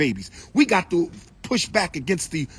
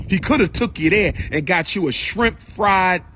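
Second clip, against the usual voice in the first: normal talking voice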